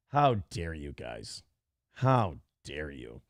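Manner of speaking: low, slow faux indignation; hammed-up offended gasp of shock; mock outrage. Mock outrage at horse jokes.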